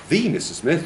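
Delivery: THE punched high as "thee"; emphasis on THE. Shown as a demonstration. Teacher meaning the one and only, THE stressed. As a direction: hit "the" high before Mister Smith. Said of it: "when we put that emphasis on it".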